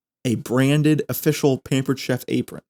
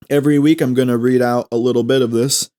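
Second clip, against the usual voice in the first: ordinary delivery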